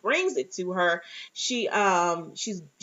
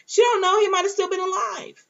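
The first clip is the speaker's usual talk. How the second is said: much higher and louder, insistent; high excited outburst; 12 semitones higher, 4 dB louder than usual. Real, not acted. Recapper arguing Mary Pat's case, high and insistent. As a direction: push the point up high with conviction. Heated aside defending the theory.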